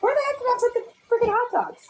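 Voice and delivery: high, fast, frazzled; searching in a rush; frantic muttered search; exasperated. Real, not acted. Frantic search for hot dogs.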